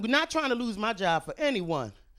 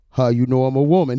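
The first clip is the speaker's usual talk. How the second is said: deep man voice; 12 semitones lower, 8 dB louder than usual. Pretend.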